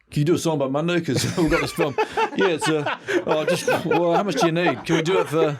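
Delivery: giggling parody of a panicked film exec; flustered producer. Retold or imitated. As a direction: play the dithering producer while cracking up. Mimicking film producers asking for song.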